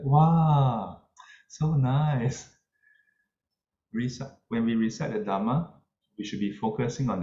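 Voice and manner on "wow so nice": higher, admiring wow from an impressed listener. Retold or imitated. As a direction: gush over the chanting voice.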